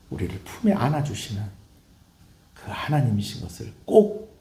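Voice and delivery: ordinary delivery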